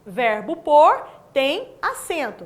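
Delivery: a teacher stressing that pôr keeps its accent; emphatic rule. Shown as a demonstration. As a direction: spell the rule out slowly, pressing pôr. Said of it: "Ou seja".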